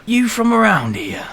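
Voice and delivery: put-on suspicious old shopkeeper. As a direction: question strangers as a wary old local.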